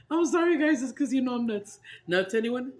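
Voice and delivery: loud and giggly after laughing. Real, not acted. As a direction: still laughing, shouting apologies. Recovering from a laughing fit.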